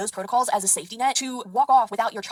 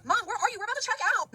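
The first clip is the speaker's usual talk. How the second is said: much higher mock-girl voice; imitating girl on phone; 11 semitones higher than usual. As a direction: imitate a kid impatiently calling Mom. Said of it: "Not exact tone".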